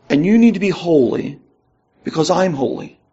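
easing down after the commands; understated voice of God, drawing to a close; God as Father. Acted. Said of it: "in that tone".

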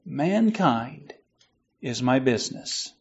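very soft, low ghostly close; hushed. Acted. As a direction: let it fade to a near whisper. Ghost's final words, very soft.

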